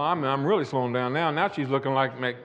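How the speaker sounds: normal talking voice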